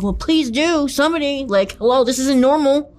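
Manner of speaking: high-pitched, pleading, half-frantic; desperate plea. Retold or imitated. Retold plea to the neighbor for help, high and frantic. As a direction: replay begging someone to call the cops.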